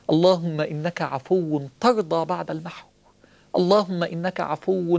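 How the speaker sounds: slow, drawn-out supplication; slow prayer. Acted. Preacher leading the congregation in prayer, slow and pleading. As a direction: plead each word slowly to God.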